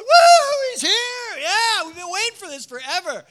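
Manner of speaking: a crowd that has waited forever, finally celebrating; ecstatic cheering. Acted.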